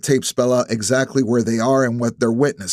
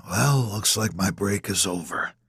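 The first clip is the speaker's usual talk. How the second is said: guarded antagonist; 2 semitones lower, 6 dB quieter than usual. A narrator's reading.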